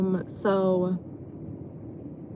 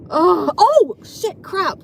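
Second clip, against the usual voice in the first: louder, higher groan of dismay; exasperated, pitched-up swearing; real frustrated outburst; 7 semitones higher, 6 dB louder, much slower than usual. Real, not acted. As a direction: curse as you remember something you forgot. Suddenly realizes she forgot a topic.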